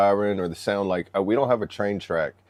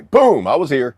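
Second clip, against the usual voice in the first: loud, higher swaggering announcement; brash loud boast; 7 semitones higher, 10 dB louder than usual. Retold or imitated. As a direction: burst in like a raider. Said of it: "They just come in and say".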